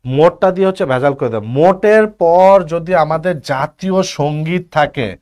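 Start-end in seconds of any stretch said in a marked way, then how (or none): 1.6-5.1 মোটের stressed, whole phrase slow and loud; slow emphasis. Shown as a demonstration. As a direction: lean hard on মোটের and slow down. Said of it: "মাথায় রাখবা".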